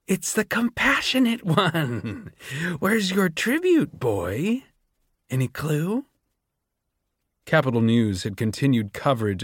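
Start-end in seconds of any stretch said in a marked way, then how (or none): 0.1-6.0 much higher, slow and sneering; Doctor Gall mocking Sejanus about his missing tribute; mocking mad doctor. A narrator's reading. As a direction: taunt the boy with cruel amusement. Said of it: "Doctor Gall laughed".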